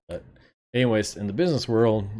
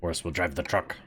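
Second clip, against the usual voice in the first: put-on barely-there Russian accent, mostly own voice; a weak stab at a Russian accent; half-hearted Russian accent for a truck driver; failed Russian accent; 2 semitones lower than usual. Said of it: "That's not a Russian accent at all".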